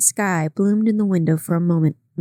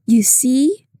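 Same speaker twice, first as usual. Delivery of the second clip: mild triumphant cry, higher; a touch of triumph, higher pitched; 5 semitones higher, 3 dB louder than usual. A narrator's reading. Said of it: "cried Catherine triumphantly".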